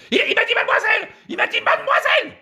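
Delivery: high, loud falsetto wail; outraged woman. Acted. Woman denouncing the man to police, outraged shriek.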